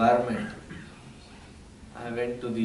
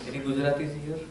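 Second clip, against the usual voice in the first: ordinary talk, nothing marked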